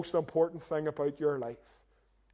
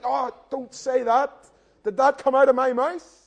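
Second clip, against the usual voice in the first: mock dismay; 6 semitones higher, 6 dB louder than usual. Inner dismay at own words.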